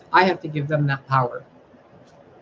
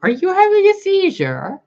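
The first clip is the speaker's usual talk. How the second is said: incredulous; 6 dB louder than usual. Real, not acted. Mocking the channelers.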